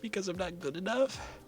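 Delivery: high, breaking, tearful questions; high sobbing cry. Acted. Asking Jisoo why everyone leaves, sobbing high. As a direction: plead through sobs, voice cracking.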